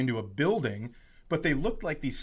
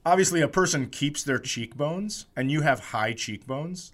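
ordinary talk, nothing marked

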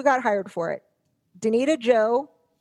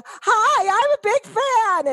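eager fan; 10 semitones higher, 3 dB louder, slower than usual. Retold or imitated. Fan greeting a celebrity.

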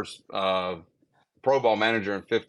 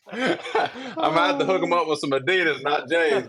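laughing; 6 semitones higher than usual. Real, not acted. Laughing through his reply.

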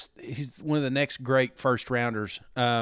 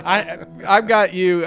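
laughing; 6 semitones higher, 7 dB louder, slower than usual. Real, not acted. Laughing at the NIL money joke.

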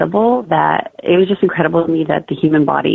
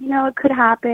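high, easy lilt; mild, unworried imitation of a midwife; imitating calm midwife; 9 semitones higher than usual. Midwife answers news of broken water, high and calm. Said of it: "you could tell in her voice".

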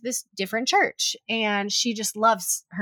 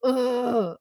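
drawn-out groan of annoyance; annoyed groan. Retold or imitated. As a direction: act out a long, exasperated ugh. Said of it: "can't quite hide all my annoyance".

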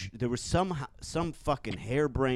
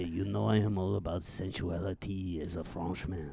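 a hammed-up French accent; sultry Frenchman in a hammed-up French accent; 3 semitones lower, 4 dB quieter than usual. Puppeteer voicing the snake, in a hammed-up French accent.

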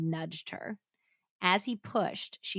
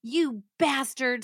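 mild narrated scream; 6 semitones higher, 3 dB louder, slower than usual. A narrator's reading. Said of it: "Susan screamed".

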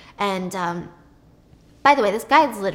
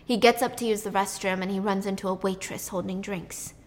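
ordinary talk, nothing marked

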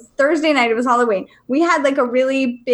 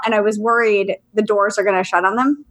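usual speaking voice

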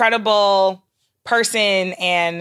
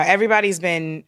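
normal talking voice

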